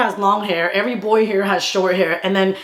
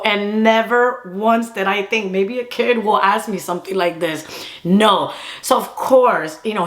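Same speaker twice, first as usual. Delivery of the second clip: ordinary talk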